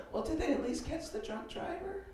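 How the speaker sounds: high, soft imitated woman's voice; concerned date. Shocked date asks about the driver, imitated high soft voice. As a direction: ask in a tiny mock-sympathetic voice. Date responds to tragic story.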